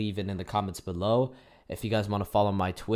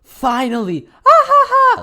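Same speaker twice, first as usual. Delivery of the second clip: evil laugh; 13 semitones higher, 12 dB louder than usual. Retold or imitated. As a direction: shout it much higher and louder, then burst into a cackling villain laugh. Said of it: "he does an evil-ass laugh".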